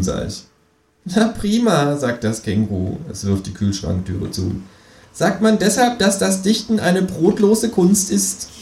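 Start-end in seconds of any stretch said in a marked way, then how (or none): 1.0-2.0 high, sarcastic kangaroo. A narrator's reading. 5.1-8.5 high, slow teasing kangaroo. A narrator's reading. Kangaroo mocking joke.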